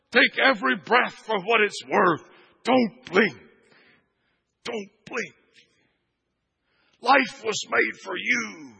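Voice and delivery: high, loud, punched sermon phrases; full-force preaching. Acted. Preacher urging the congregation to live it all, impassioned full-force preaching.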